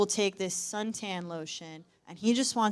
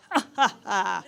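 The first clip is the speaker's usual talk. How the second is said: forced laugh; 3 dB louder than usual. Acted. Dad deflecting airport security's question, forced laugh. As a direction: fake "ha, ha, ha" chuckle.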